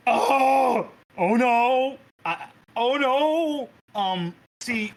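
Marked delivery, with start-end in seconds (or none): 0.1-3.7 high, loud, slow dismay; alarmed oh no. Real, not acted. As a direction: react in mounting horror. GM reacts to drinking.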